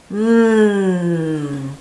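savoring mmm of delight; happy mmm. Acted. Camilla tasting the beans, pleased mmm. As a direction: hum with pleasure at the taste.

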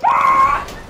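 high loud yelp of alarm; panicked yelp. Real, not acted. Panicked while running.

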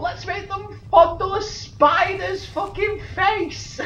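high, loud, drawn-out mockery; incredulous mocking rant. Player mocking the game makers' idea, high incredulous rant. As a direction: stretch it out high and loud in disbelief.

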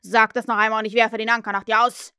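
loud, forceful warning; loud threat. A narrator's reading. Peter as Hook threatens the real one, loud warning. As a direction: threaten them at full voice.

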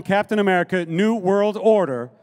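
usual speaking voice

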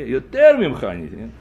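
normal talking voice

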